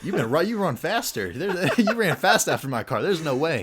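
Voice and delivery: loud, high incredulous retort through laughter; laughing mock protest. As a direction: play the disbelieving friend, cracking up.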